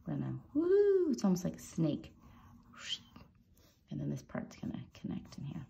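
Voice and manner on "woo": a high swooping woo. Real, not acted. Tutor voicing the pen's snake-like swoop with a high woo. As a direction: sing out a quick high woo as the pen swoops.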